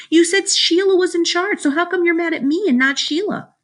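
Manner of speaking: high, whiny argument; indignant protest. A narrator's reading. Boy asks mother why he is blamed, high indignant protest. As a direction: argue back like a wronged child.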